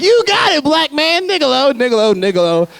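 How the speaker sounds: gleeful, high-pitched chant, easing down; sing-out delight, starting very high. Retold or imitated. Two fans answering the comic, in mock joyful glee. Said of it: "they had so much joy".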